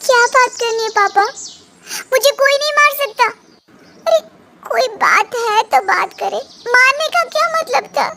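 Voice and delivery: high, heated protest; wife protests to her parents about the slap, indignant. Acted. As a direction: stand up for yourself, fiercely. Daughter protests being slapped.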